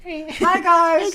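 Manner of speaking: squeaky high, loud mock-girl greeting; high-pitched girl voice. Friends mimicking every girl's greeting, high-pitched. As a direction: put on a really high, excited "hey guys". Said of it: "Just a really high pitched".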